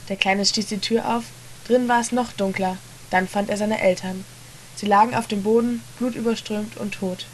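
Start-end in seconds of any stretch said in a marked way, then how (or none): none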